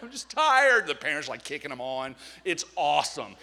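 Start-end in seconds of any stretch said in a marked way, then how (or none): 0.0-0.9 put-on much higher and louder, a worn-out child's whine; whiny tired kid. Preacher imitating a worn-out child at Disney World, much higher and louder whine. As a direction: whine it like an exhausted kid at four pm.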